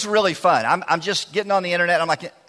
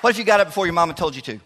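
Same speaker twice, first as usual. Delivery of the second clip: usual speaking voice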